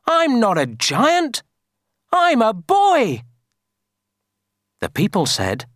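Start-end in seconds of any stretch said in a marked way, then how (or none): 0.1-3.2 put-on high-pitched boy's protest; shouting. A narrator's reading. Kipper telling villagers he's a boy, shouting. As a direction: insist loudly, voice pitched up. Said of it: "shouted Kipper".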